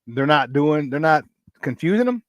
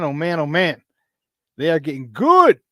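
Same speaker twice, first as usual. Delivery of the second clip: ordinary delivery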